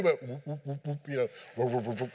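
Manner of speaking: low, soft whooping fish sounds; lightly voiced whoop whoop of a fish; fish swimming noises. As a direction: mimic a fish gliding about, hushed.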